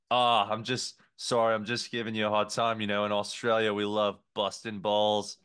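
put-on Aussie waiter excusing himself; fake Australian accent. Waiter apologizing for teasing a customer, fake Australian accent. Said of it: "seemingly fake Australian accent".

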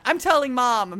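loud, high mock threat to tattle; tattling kid. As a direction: yell it like a wronged little sibling. Mimicking a child tattling in fight.

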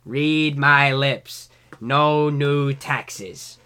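put-on very slow old-man president; George H W Bush. Showing how an elderly president talks, very slow. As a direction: drag the words out like an old man. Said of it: "sounds like an old man".

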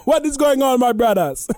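high, loud put-on African accent. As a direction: call out in a mock African accent. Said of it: "that's why I go on African".

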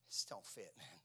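soft, whispery and quick; half-whispered quote; hushed refusal. Retold or imitated. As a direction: voice David's quiet refusal under your breath. David quietly declines the armor.

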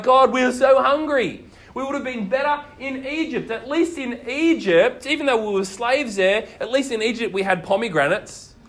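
whining hungry Israelites. Retold or imitated. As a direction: moan about missing Egypt's food. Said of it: "They grumble and argue against God".

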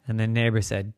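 plain reading voice

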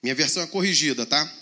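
ordinary delivery

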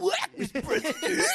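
high, loud laughing mock delivery; cracking up while parodying the odd delivery. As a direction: squeal the line out through laughter, mocking it. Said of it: "Why are you talking like that?".

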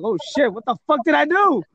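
high, loud shocked cry. Retold or imitated. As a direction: sound stunned and confused. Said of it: "I was like".

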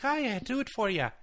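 very high, playful Irish assistant voice; Irish Siri. Pretend. Phone assistant agreeing to the task, high mock Irish accent. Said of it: "And Siri's like".

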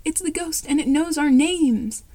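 a frightened cry, rising to a loud yell; terrified. Acted. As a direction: panic as the ghost calls your names.

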